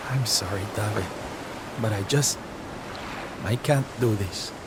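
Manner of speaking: low, soft, halting confession; heavy with grief and defeat; despondent. Acted. Sylve mourning the lost companions to Davi, despondent. Despairing over lost friends.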